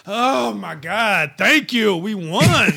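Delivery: mock the coach thanking heaven for a win; mock relief. Mocking the coach after a rare win, hammed-up relief.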